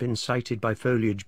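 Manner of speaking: ordinary delivery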